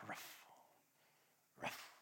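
soft dog bark ruff; a quiet mock dog bark. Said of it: "we bark".

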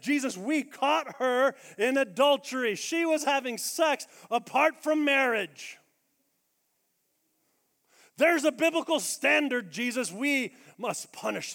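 loud, slow, self-righteous accusation; accusers' loud charge. Retold or imitated. Religious men shouting their accusation at Jesus. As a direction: hammer the charge at Jesus like a zealot.

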